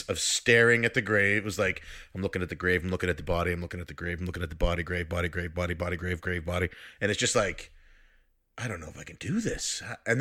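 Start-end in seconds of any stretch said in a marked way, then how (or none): 2.1-6.7 low, rapid monotone loop; fixated looping. Retold or imitated. Player frozen at the graveside, fixated looping. Retelling five minutes of frozen staring. 8.6-9.8 low, soft self-doubt; daunted. Retold or imitated. Player staring at the grave, quietly daunted. Retold hesitation before burying body.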